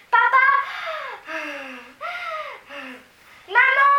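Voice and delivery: high, loud call to her parents; breathless cry. A narrator's reading. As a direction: shout for them, out of breath. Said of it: "cria Mathilda à bout de souffle".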